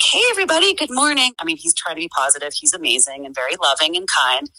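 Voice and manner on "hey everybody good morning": much higher and louder, a sing-song hello; a bouncy, beaming morning greeting; overly cheerful greeting. Retold or imitated. Husband bursting into the kitchen to greet grumpy teens, high and chirpy. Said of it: "my husband bebops into the kitchen like".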